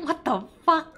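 shocked yelp. Real, not acted. Shocked by strange game text.

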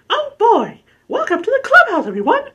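loud, high falsetto cartoon mouse; terrible Mickey Mouse. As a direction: badly fake a cheery mouse in squeaky falsetto. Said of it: "that's my terrible Mickey Mouse impression".

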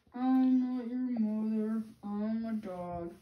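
slow, lightly put-on dog voice; a dog speaking plainly and slowly. Dog answering the baby bird, slow dog voice.

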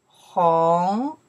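红 loud, drawn out and rising; isolated 红 with a clear rising glide; second tone. Shown as a demonstration. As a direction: stretch 红 upward from a low start. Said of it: "The second tone".

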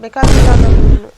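loud, sharp rejection; sudden loud refusal; angry outburst. Acted. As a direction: throw the words at him. Wife refuses husband angrily.

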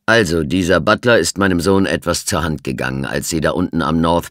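put-on loud, emphatic old colonel; firm colonel. A narrator's reading. The colonel defends his son to the inspector, firmly. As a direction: insist on the story. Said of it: "Stimme klang bestimmt".

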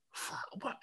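whispered, under the breath; a stunned whisper to yourself. Retold or imitated. Alone on the platform, whispering in shock.

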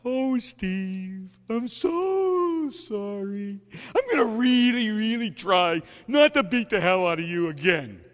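loud, wailing, hammed-up sobbing sorry; high loud fake-weepy apology; mock crying apology. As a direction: overact a tearful plea. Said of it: "and I'm crying this time".

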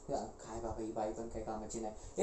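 very low, soft mutter; hushed low-pitched grumble; grumbling aside. Acted. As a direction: mutter a complaint to yourself. Grumbles aside about the woman.

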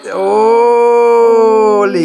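very slow, stretched-out amazement; amazed. Real, not acted. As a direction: draw each word right out in wonder. Amazed reaction for the child.

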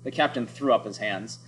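plain reading voice

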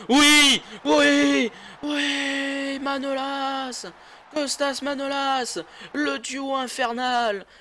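high, slow hyped goal call; esports caster. Acted. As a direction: announce the scorer like a caster.

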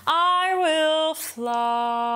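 "fly" sung with a still jaw, the diphthong made by the tongue alone; sung line with a jaw-still diphthong; tongue-only diphthong. Shown as a demonstration. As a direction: keep the jaw frozen on "fly". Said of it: "not move it at all for fly".

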